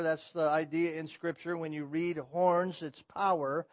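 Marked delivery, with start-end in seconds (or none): none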